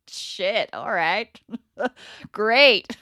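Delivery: imitated helpless giggly reaction; retold nervous laughing. Acting out an uncomfortable reflex to change, laughing. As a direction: laugh between the words, landing loud on Great. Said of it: "I just start laughing".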